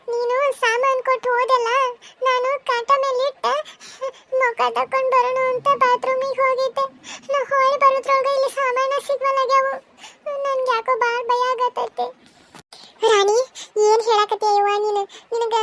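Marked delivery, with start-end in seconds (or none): 0.1-9.7 high, weepy pleading; a crying bride's broken voice; tearful. Acted. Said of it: "ಯಾಕೆ ಅಳಾತಿಯ?". 10.5-12.1 higher, slow weepy confession; crying while admitting fear; tearful fear. Acted. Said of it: "ಯಾಕೆ ಅಳಾತಿಯ?".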